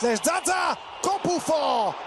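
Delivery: high shouted call; excited. Real, not acted. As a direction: shout at the post hit. Shot hits the post.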